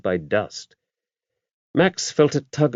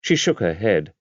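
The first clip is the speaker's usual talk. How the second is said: ordinary delivery, nothing marked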